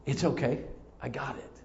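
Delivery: soft, low, forgiving murmur; hushed, accepting tone; gentle reassurance. Acted. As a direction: quietly let a friend off the hook. Jesus' forgiving look voiced.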